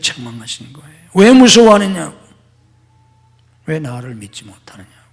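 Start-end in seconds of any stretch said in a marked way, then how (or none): 1.1-2.2 loud rebuke. Acted. Quoting Jesus' rebuke loudly. 3.7-5.0 whispered rebuke. Acted. Quoted rebuke dropped to whisper.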